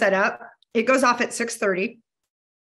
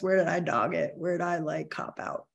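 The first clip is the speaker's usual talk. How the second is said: sheepish confession; 4 semitones lower, 8 dB quieter than usual. Shown as a demonstration. Admitting lapses, voice drops away.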